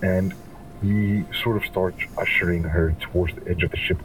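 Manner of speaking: normal talking voice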